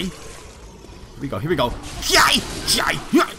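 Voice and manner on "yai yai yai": short, sharp "yai" yelps in a burst; one yelp per strike; battle cries. Real, not acted. Player swinging repeatedly at an enemy, battle cries.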